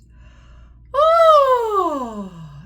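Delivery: loud high sigh sliding down; high sighing siren. Shown as a demonstration. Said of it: "go up as high as you can".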